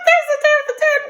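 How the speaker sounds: very high, loud, laughing disbelief; squealed mock surprise with a laugh. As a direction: act shocked to hear it.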